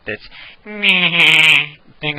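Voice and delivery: Peter Griffin voice. Shown as a demonstration. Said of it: "Bronx accent".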